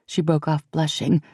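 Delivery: ordinary delivery, nothing marked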